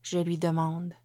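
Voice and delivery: plain reading voice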